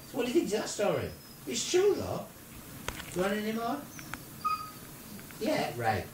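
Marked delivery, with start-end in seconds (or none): none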